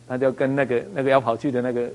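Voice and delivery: ordinary delivery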